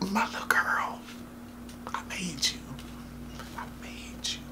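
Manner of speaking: mock-emotional dad, whispering; tender whisper. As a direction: breathe it to your long-lost child. Father reunites with daughter.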